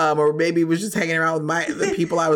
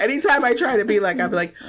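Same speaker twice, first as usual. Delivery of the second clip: ordinary delivery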